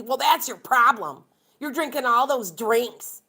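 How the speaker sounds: retold loud, accusing verdict; uncompassionate doctor. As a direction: imitate a doctor laying blame, emphatic and slow at the end. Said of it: "you'd think he'd have a little bit of freaking compassion".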